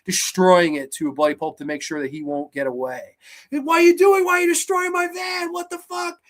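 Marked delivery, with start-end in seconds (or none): 3.5-6.2 high-pitched frantic yelling; acted-out victim screaming in disbelief; outraged shout. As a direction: yell in shocked outrage, voice way up. Man catches his van being destroyed.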